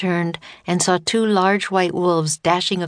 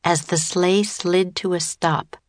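ordinary delivery, nothing marked

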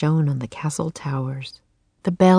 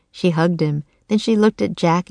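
ordinary delivery, nothing marked

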